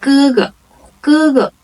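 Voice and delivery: 哥哥 with a high, level first syllable. Shown as a demonstration. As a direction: start 哥哥 high, let the second part go neutral. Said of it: "is the first tone".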